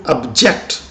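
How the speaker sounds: "object" with the weight on JECT; stress on second syllable. Shown as a demonstration. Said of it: "place the primary stress at the second syllable".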